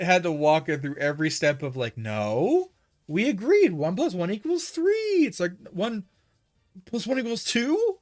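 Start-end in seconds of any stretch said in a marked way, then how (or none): 2.0-5.2 high, insistent mock bossing; pushy user. User forcing the AI to agree, mock insistent. 5.7-8.0 high, questioning little robot voice; timid AI. Retold or imitated. AI meekly answering the user, timid mock voice.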